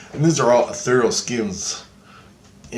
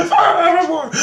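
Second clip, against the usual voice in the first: high, loud yell with laughter in it; retold battle cry, high and loud; triumphant shout; 7 dB louder than usual. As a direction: shout it like a magic command. Repeats shouted command word.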